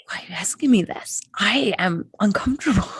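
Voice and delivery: whispered, squirming and defensive; uncomfortable whisper. Retold or imitated. Asked if you're okay, whispering back uneasily. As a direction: hush it like you're caught off guard. Imagined reaction to "are you okay.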